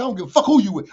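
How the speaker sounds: loud, pushy brush-off; retold dismissive snap. To the rapper naming RCA, a loud dismissive retort. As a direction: wave his label away with force. Rapper brings up his label.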